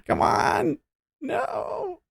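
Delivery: very high mock-pleading falsetto; squeaky pleading.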